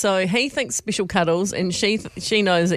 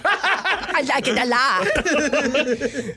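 very slow, higher, hammed-up lecherous mimicry; mock drooling relish; 6 semitones higher, much slower than usual. Co-host mocking dad's confession, exaggerated drooling relish. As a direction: draw it out as if dribbling, through laughter. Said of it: "say it with a dribble".

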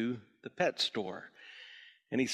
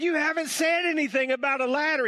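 much higher and louder, indignant; indignant customer; 14 semitones higher, 5 dB louder than usual. Acted. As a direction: protest the surprise ladder. Customer outraged at new item.

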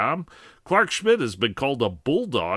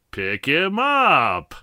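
slow, drawn-out, louder; slow punchline; 4 semitones higher, 4 dB louder, much slower than usual. Acted. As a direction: stretch the punchline slowly.

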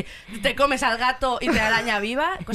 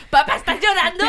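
higher, louder teasing through laughter; laughing teasing; 10 semitones higher, 8 dB louder than usual. Retold or imitated. Teasing her crying dad, laughing, higher and louder. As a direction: tease dad while cracking up. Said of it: "yo siempre le miraba en plan".

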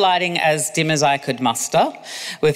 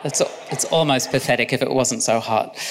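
ordinary delivery

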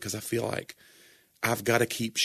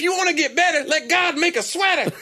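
mock preacher rhyme; 5 dB louder than usual. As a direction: preach a silly rhyme in a high voice. Mock sermon rhyme, laughing.

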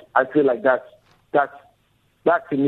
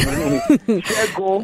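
laughing; 10 semitones higher than usual. Real, not acted. Correcting name, laughing.

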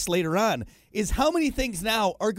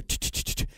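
whispered tch tch flush sound; imitated flush noise; 6 dB quieter, faster than usual. Dad retelling the bug flush, imitated flush noise. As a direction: mimic a toilet flushing under your breath.

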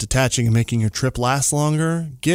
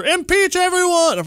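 mock shrill outcry; 13 semitones higher than usual. Mocking internet outrage quotes.

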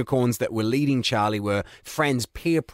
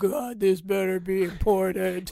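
grumpy unicorn; 5 semitones higher than usual. Retold or imitated. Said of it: "we just acted out the entire thing".

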